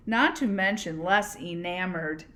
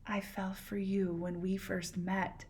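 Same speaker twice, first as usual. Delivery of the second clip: much softer confession in his ear; whispered; 9 dB quieter than usual. Acted. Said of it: "she whispered something in his ear".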